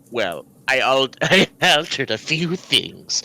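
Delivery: low, creepy sly voice; Peter Lorre voice. Retold or imitated. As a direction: purr it out like a sinister old-movie character. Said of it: "Peter Lorre?".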